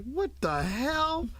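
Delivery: dragged out in shock; stunned disbelief. Retold or imitated. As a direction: mom waking to the record, slow stunned what the hell. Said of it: "I was like".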